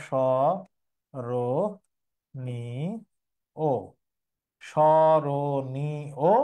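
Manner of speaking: স্মরণীয় broken into স, র, নি, ও with pauses between; syllable by syllable. Shown as a demonstration. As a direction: spell out স, র, নি, ও slowly, one syllable at a time. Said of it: "স্মরণের উচ্চারণ হচ্ছে এরকম".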